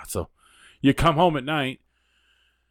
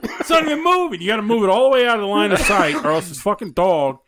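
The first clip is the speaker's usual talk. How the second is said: exasperated yelling; 11 semitones higher than usual. Retold or imitated. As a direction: yell at the dog in disbelief. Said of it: "I'm like".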